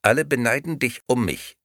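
sweet, empty doll voice; talking doll. Acted. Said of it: "antwortete die Puppe".

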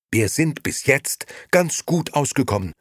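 high, loud comeback; retorting. A narrator's reading. Liliana contradicts her husband, loudly retorting. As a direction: answer back firmly. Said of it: "gab Liliana zurück".

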